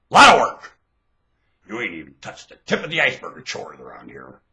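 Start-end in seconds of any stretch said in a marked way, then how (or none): 0.1-0.8 high, loud yell; sudden loud outburst; shouted repeat. Real, not acted. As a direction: punch it out at full voice.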